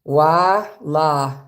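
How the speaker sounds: "Wa" and "la" said slowly and clearly as Arabic syllables; Arabic letter demo. Shown as a demonstration. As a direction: sound out "Wa, la" like a teacher. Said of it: "This is the wa sound here".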